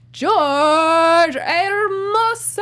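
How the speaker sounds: slow, drawn-out ring announcer call; [name]'s wrestler announcer voice. Acted. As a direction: stretch the name out like a ring announcer. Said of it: "uh, wrestler announcer voice".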